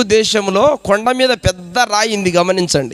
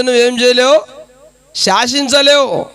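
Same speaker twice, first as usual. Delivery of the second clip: emphatic preaching cry; 5 semitones higher than usual. Real, not acted. Preacher hammering his point.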